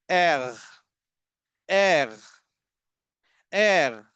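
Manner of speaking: "एर" ending in a throaty gha sound; teacher models German R; German R demo. Shown as a demonstration. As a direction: let "एर" end in a guttural gh, like Ghajini. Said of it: "यही गा sound letter आर के एंड में आता है".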